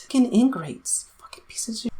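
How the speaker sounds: harsh whispered curses under the breath; whispered insults. Real, not acted. Ranting at Fox News, then whispering insults. Muttered insults after angry rant.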